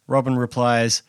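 ordinary talk

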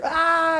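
high, loud shriek of panic; child's scream. Retold or imitated. Child sees the tweezers coming and shrieks, high and loud. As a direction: scream like a terrified kid. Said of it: "they scream, they lose it".

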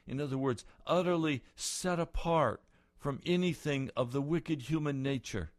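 loud, high, rising sermon voice; fervent preaching. Real, not acted. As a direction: build the vision with fire. Preaching at full force.

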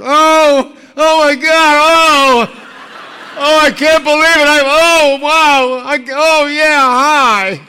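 high, loud squealing with laughs; starstruck gushing fan. Retold or imitated. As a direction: gush like a fan who just reached her idol. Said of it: "a young female voice said".

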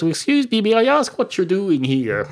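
high-pitched, put-out challenge; mock affronted. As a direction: demand an explanation, voice jumping up high. Joseph challenges the talking cat.

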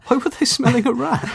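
high, loud laughing "why"; laughing question. Real, not acted. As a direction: crack up while asking. Host laughs at the phrase.

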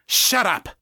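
sharp, high snap; snapped. A narrator's reading. Lisa silencing the intruder, snapped. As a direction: snap it as a curt order. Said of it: "Lisa snapped".

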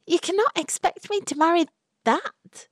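put-on high, appalled young woman refusing; young woman's voice. A narrator's reading. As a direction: voice Florrie, high and aghast. Florrie protests the marriage.

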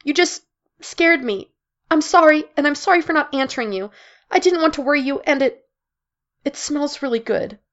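high, choked, through tears; tearful apology. Acted. Mara apologizing to Jill while crying, high and tearful. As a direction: sob it out between breaths.

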